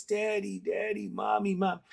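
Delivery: put-on little child voice. Father remembers his son's little voice, imitating a toddler calling out. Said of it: "that little child voice".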